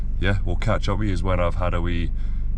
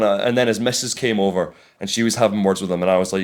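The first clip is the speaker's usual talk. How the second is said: normal talking voice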